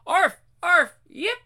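high yapping barks; dog barking. Acted. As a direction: bark like a small dog.

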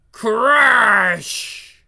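loud crashing sound effect; shouted crash sound. Acted. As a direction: belt out a crash noise. Voiced crash during action.

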